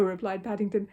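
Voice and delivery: usual speaking voice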